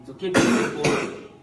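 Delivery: ordinary talk, nothing marked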